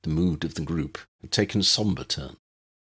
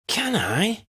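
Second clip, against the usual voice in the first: much higher, hopeful question; wondering question. A narrator's reading. Lydia asks her uncle hopefully, much higher. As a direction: ask it full of wonder.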